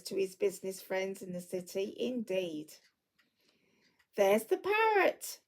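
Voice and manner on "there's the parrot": much higher, slow and delighted; excited cry. Acted. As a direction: point out the parrot in wonder. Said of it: "cried Scrooge".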